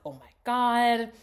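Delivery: high, slow, loud gasped oh my God; hammed-up shock; mock shock. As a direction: play the stunned realization. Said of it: "it's like".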